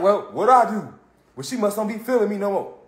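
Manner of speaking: quietly puzzled, acted letdown; mock dejection. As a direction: trail into soft, wounded resignation. Acting the ignored boy's reaction.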